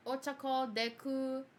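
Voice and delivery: ordinary delivery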